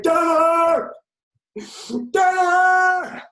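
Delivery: long, drawn-out high scream; full-force, high-pitched holler; shouted. Acted. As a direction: shout it after a fleeing car. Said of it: "And I shouted".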